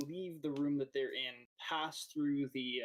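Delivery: normal talking voice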